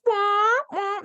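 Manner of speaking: high siren wail; mock red alert siren, high; imitating an alarm. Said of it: "it goes".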